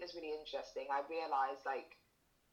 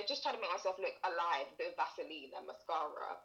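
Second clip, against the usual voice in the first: ordinary talk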